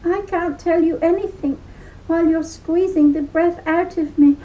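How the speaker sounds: high, fast, breathless little voice; gasping leprechaun. Acted. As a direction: squeeze the words out short of breath. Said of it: "the leprechaun gasped".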